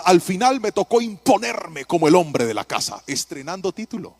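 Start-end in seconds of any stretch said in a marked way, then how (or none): none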